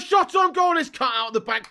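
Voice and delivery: loud relieved shout. Real, not acted. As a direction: call it out loud. Live reaction to shot.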